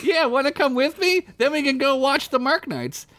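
put-on high eager boy voice; eager young squirrel. Derek invites Squeak to the market, high and eager. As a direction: invite her brightly. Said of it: "Derek invites Squeak".

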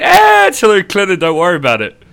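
high, loud mock brush-off; dismissive FBI. Mimicking the FBI letting Clinton off, dismissive. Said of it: "and they just went".

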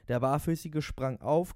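plain reading voice

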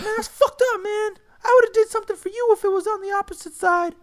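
very high, loud, fast hurt whine; high hurt complaint. Retold or imitated. Friend two whining about betrayal, high and fast. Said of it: "And they're like".

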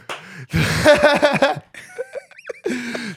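long high laugh. Real, not acted. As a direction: burst out laughing. Laughs at his joke.

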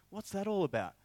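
high-pitched baffled query; puzzled question. Retold or imitated. Leader asking Charles about the mob, puzzled. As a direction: act out bewilderment, pitched well up.

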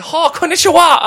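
shrieked high, loud greeting; hammed-up Japanese caricature greeting; mock Japanese caricature. Family giving her clues for Japanese, mock caricature greeting. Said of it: "and like".

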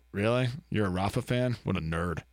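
a lightly voiced sneer, dropping low by the end; a touch of scorn for a passerby; mock disdain. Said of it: "you're like".

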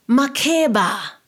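slow, loud, troubled calling of the name; uneasy drawn-out cry of the name; disturbed call. A narrator's reading. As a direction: call out as if in danger. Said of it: "She sounded disturbed".

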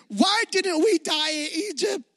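high mock shout; dramatic outcry. Israelites crying out to Moses, hammed-up shout. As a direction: overdo the wailing complaint. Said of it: "They say this".

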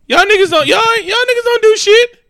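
loud, high-pitched incredulous rant; exasperated outburst. Retold or imitated. Co-host defending what the nineteen-year-old said, exasperated outburst. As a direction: pitch climbing sharply into a squeal of disbelief. Mock outburst over the interview answer.

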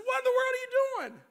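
put-on high, loud startled shout at the boy; dad yelling from the front seat at his son; shocked yell.

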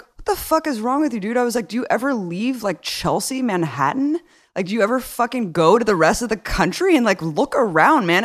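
high, exasperated mock of his own comeback; incredulous retort. Replaying his exasperated answer to Larry King, pitched high. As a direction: throw it back high and incredulous. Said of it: "And I was just like".

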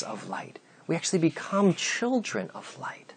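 hushed whispered words; soft unvoiced preaching aside. Real, not acted. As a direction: drop to a whisper for the point. Sermon point dropped to whisper.

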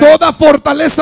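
high, loud pulpit prayer; forceful prayer. Acted. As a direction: pray it out with full force. Prays at full force.

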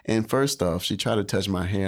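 usual speaking voice

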